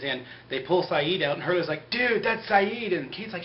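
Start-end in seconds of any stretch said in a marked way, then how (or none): 1.9-3.0 mock surprised Hurley.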